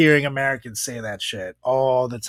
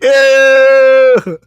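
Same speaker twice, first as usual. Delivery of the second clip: high, loud grossed-out "Ew!"; disgusted squeal; 12 semitones higher, 12 dB louder than usual. Retold or imitated. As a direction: shriek it in disgust. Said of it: "It's like".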